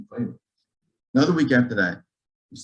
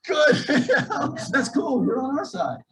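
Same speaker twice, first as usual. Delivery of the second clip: laughing; 14 semitones higher than usual. Real, not acted. Laughing at pharmacist's excitement.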